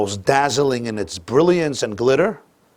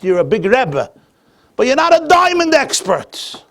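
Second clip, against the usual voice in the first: frustrated merchant; 7 semitones higher, 4 dB louder than usual. Retold or imitated. Merchant protests to the Rebbe, rising frustration. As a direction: pitch climbing higher and louder, exasperated. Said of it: "So a little frustrated".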